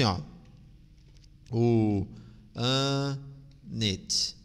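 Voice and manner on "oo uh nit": "O an it" in three separate drawn-out pieces, oo, uh, nit; sound by sound. Shown as a demonstration. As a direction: break "O an it" slowly into oo... uh... nit. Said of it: "Vai ficar alguma coisa assim". ("O an it" separado devagar, som por som: u... ã... nit)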